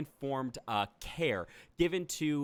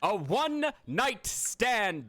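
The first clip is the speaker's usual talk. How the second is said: drawn-out repeat with a higher start; slow dramatic announcement; 7 semitones higher, 3 dB louder, much slower than usual. Acted. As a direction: announce it slowly once more.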